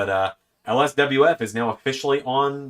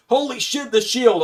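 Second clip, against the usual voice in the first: excited; 9 semitones higher than usual. Retold or imitated. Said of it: "a lot of people didn't immediately go".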